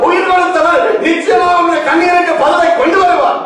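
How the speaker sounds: high, full-force sermon delivery; ringing preacher's cry; fervent preaching. Acted. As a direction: proclaim it urgently to the congregation.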